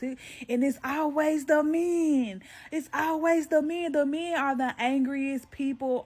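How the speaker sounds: fed-up rant; exasperated. Real, not acted. Ranting at angry men.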